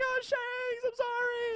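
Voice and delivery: very high mock child apology; little kid voice. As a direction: squeak it like a sorry little boy. Voicing crying child Luffy.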